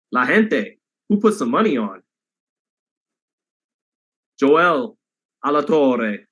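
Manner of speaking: name called out in a mock Italian accent, rolled r. Shown as a demonstration. Streamer shouting out a viewer by name, in mock Italian accent. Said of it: "I made you Italian on that one".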